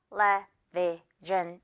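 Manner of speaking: "television" in four separate, slowed chunks; syllable by syllable. Shown as a demonstration. Teacher shows the four syllables of television, syllable by syllable. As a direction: space out each syllable of "television". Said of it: "이런 식으로요".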